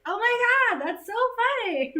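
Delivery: high, loud mock-delighted squeal; amused high voice. Actress telling the real Anna, high squealing laugh. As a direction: squeal it out, tickled. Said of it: "And she's like".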